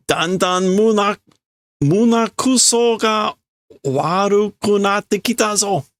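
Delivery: high, rough complaint; growing disgust. Acted. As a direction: let the disgust boil over. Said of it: "getting rougher and rougher".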